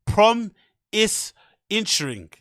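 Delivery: "Prom", pause, then a slow "is intring"; mocking "Promising" said as "Prom is intring"; imitating Brendan's pronunciation. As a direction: drawl "is intring" slowly like a lazy mumble. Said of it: "that's how he pronounces promising".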